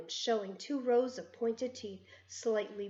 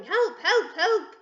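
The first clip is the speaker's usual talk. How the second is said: high, loud panicky cries with a laugh; mock yelling teacher; 9 semitones higher, 8 dB louder, slower than usual. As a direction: shriek like a terrified teacher, mocking.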